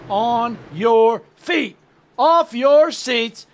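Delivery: rally the crowd at the green flag; hyped race call. Acted. Race start hype call.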